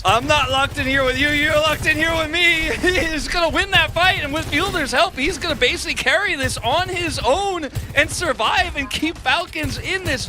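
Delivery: high, loud hype-caster eruption; excited shouting. Real, not acted. Caster hailing a player carrying alone, excited shouting. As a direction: shout the clutch play at full tilt.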